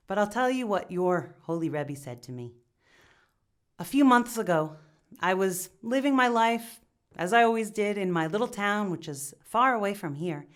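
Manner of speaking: usual speaking voice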